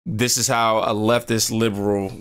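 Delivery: ordinary talk, nothing marked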